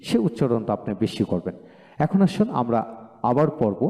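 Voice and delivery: ordinary talk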